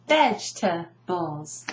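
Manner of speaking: "Vegetables" slowly as vej, tuh, bulls; "Vegetables" chopped into three beats, no middle E; broken into syllables. Shown as a demonstration. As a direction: say "Vegetables" in three separated chunks. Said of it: "I break it down completely".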